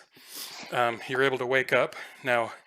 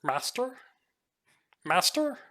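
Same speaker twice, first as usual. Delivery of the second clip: high, questioning monkey voices; baboon creatures; 11 semitones higher than usual. Acted. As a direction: squeak it like a confused ape. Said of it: "you hear a couple of them say".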